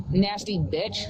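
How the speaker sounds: usual speaking voice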